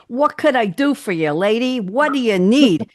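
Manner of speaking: hammed-up New York "lady" greeting; put-on New York accent. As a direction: imitate a pushy New York shop man. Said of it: "I don't talk like that".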